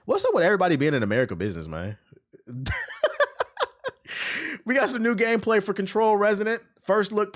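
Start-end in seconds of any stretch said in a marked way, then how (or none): 2.5-4.6 high-pitched cackling laugh. Real, not acted. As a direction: burst into a high giggle. Laughing at his own rant.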